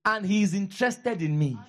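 preacher leading the church to repeat; call to repeat. Shown as a demonstration. Pastor to church, leading a line to repeat. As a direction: give it out for the congregation to echo. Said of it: "Can you say after me".

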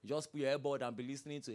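ordinary talk, nothing marked

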